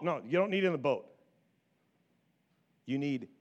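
ordinary talk, nothing marked

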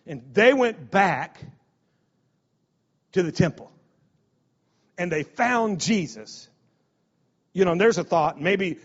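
ordinary delivery

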